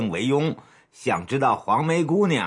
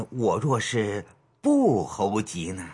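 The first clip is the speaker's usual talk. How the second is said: crafty, sly; 7 semitones lower than usual. Acted. Boss sounding out the matron's hint, crafty and sly. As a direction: test her slyly, voice dropped. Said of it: "便露出一副奸诈的神情说".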